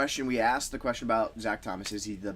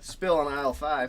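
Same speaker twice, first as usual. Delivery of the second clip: supermarket announcement; 4 semitones higher, 3 dB louder than usual. Pretend. Mock store PA call.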